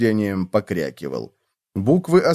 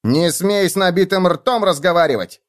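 yelling; 7 semitones higher than usual. A narrator's reading. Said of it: "завопил Клоп".

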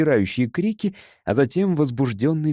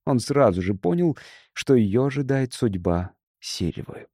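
ordinary delivery

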